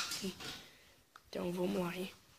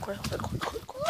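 being chased. Real, not acted. Still fleeing the creature.

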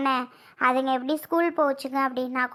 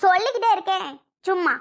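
raised-pitch, fed-up outburst; exasperated anger; 6 semitones higher than usual. Acted. Wife sick of repeating her news, exasperated. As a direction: rattle it off in irritation. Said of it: "கோவப்படக்கூடாதுடி".

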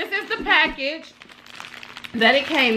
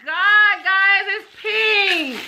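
excited; 7 semitones higher, much slower than usual. Real, not acted. Unboxing reveals pink wig.

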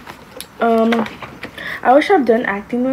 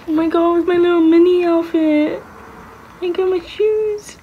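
delighted squeal; 6 semitones higher than usual. Real, not acted. Gushing over baby outfit.

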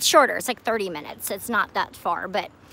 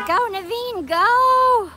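higher and louder cheer; cheering the frog on; 9 semitones higher, 6 dB louder, much slower than usual. Real, not acted. Cheering the frog to hop away, higher and louder. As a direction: urge the little frog along, excited.

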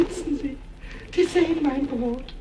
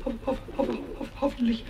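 plain reading voice

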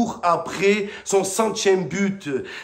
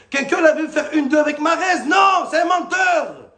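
shouting in outrage, pitch climbing high; furious fan yelling at the screen; angry shout; 6 semitones higher, 4 dB louder than usual. Real, not acted. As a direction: yell it at the liar. Angry fan rant at player.

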